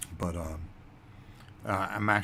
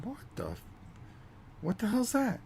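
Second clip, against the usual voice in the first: startled. Retold or imitated. Woken by alarm, startled.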